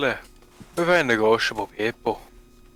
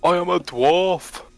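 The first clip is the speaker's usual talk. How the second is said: Scottish dwarf; 6 semitones higher, slower than usual. Shown as a demonstration. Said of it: "I assumed it was kinda Scottish".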